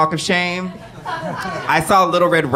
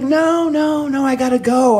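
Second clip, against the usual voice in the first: high mock refusal; 10 semitones higher than usual.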